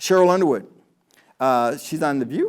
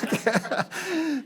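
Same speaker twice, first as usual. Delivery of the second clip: laughing; 13 semitones higher, 5 dB quieter than usual. Real, not acted. After light joke.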